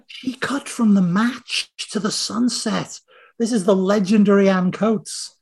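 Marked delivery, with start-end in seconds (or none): 0.1-3.0 awed aside. Retold or imitated. Marvelling at the mom's famous edit.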